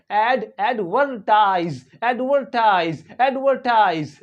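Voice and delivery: slow एडवर्टाइज with a clearly sounded R; American pronunciation. Shown as a demonstration. Teacher drills American English, slow with R pronounced. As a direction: curl the R in एडवर्टाइज, "AD-ver-tize", drawn out. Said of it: "आर है इसको pronounce करना होगा".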